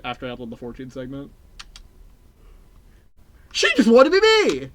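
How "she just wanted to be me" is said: much higher, louder outburst; loud exclamation. Real, not acted. Streamer ranting about a chatter, high loud outburst.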